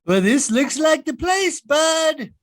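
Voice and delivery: shouting the line out loud; loud call. Acted. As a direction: call it out boldly. Arriving at horror house scene.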